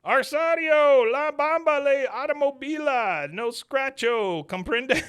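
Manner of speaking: high, loud, drawn-out yell; stretched, hammed-up shouted gibberish; Dale hollering mock Spanish. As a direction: holler down the street in fake Spanish. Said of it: "looks down the street and he goes".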